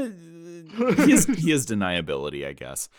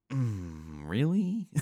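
skeptical; 3 semitones higher, 5 dB quieter, much slower than usual. Retold or imitated. Skeptical quoted reaction.

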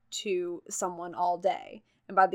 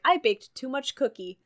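lightly put-on neighbor's accent, a bit higher; a hint of the neighbor's accent in broken English; 6 semitones higher than usual. Telling a friend about the cookie-offering neighbor, light neighbor's accent. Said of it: "my neighbor has an accent".

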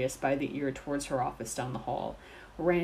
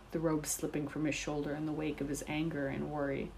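usual speaking voice

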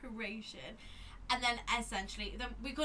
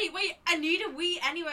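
high, louder giddy interruption; tipsy friend; 7 semitones higher, 6 dB louder than usual. Retold or imitated. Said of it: "And then she was going".

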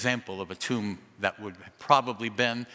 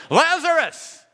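huge shout, far higher and louder; 12 dB louder, slower than usual. Acted. Jesus calling into the tomb, full shout. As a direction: call the dead out of the tomb. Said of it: "Then Jesus shouted".

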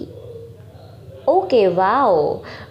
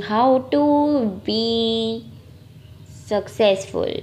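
slow musing drawl; 3 dB quieter, much slower than usual. Acted.

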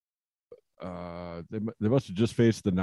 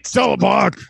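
high, loud wrestler's holler; imitated promo shout; 12 semitones higher, 11 dB louder than usual. Co-host playing a wrestler passing the mic, shouting. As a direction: shout it as a tag partner's hand-off. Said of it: "That's his promo style".

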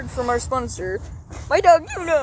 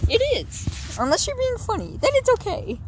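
much higher squeaky voice; 11 semitones higher than usual. Acted. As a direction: squeak it out on purpose. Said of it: "I'm doing the squeaky voice on purpose".

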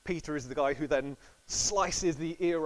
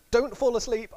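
urgent warning; 9 semitones higher, 10 dB louder than usual. Retold or imitated. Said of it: "and says to them".

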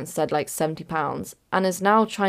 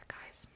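heavy sigh; 25 dB quieter than usual. Real, not acted. Building up to bad news.

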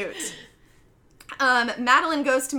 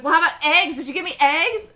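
higher, louder mock nagging; mom pressing; 6 semitones higher, 8 dB louder than usual. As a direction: imitate a mom insisting on an answer. Mom presses forgetful dad.

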